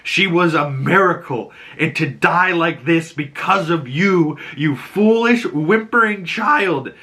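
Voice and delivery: dramatic angry tirade climbing high in pitch; pissed-off, acted. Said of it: "the girl's so pissed".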